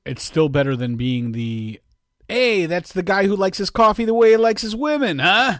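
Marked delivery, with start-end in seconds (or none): 2.3-5.6 high, loud jokey put-on voice; mock teasing barista. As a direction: ham up a cheeky barista wisecrack.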